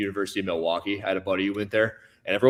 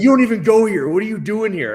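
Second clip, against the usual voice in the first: loud, high imitation of a hostile drunk; mock screaming; 11 semitones higher, 8 dB louder than usual. Drunk student challenging an outsider in the dorm, mock screaming. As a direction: yell it like someone challenging an intruder. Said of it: "he was just screaming at me".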